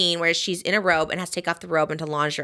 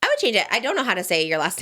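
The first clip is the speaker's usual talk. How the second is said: ordinary talk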